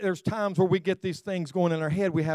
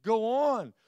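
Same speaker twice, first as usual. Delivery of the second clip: impatient urging; 5 semitones higher than usual. Retold or imitated. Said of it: "talking to the car in front of me, saying".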